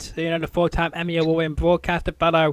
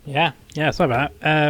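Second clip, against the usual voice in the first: ordinary talk, nothing marked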